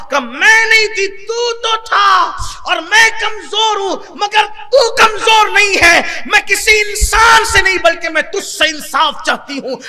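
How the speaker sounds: put-on an old woman's high, loud, tearful plea to God; old woman crying out. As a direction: wail it up to the sky with a breaking cry. (بوڑھی عورت کی بلند، روتی ہوئی فریاد)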